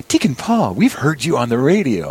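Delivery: high, delighted fan greeting, retold; excited greeting. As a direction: imitate two ladies thrilled to recognize someone. Retelling two sisters' excited greeting.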